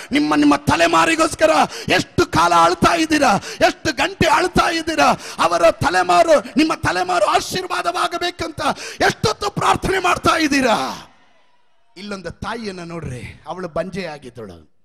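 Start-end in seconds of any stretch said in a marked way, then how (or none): 0.1-11.1 louder, impassioned sermon cry; fervent pulpit appeal; preaching at full force. Acted. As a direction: preach it out to the whole congregation. Preacher challenging the listeners.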